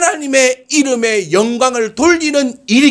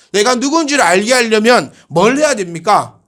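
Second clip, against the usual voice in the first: ordinary delivery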